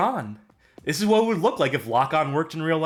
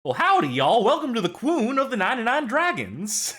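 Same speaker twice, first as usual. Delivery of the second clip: southern twang; 10 semitones higher than usual. Retold or imitated. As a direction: greet them like a Texas host with a southern twang. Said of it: "slips into southern twang".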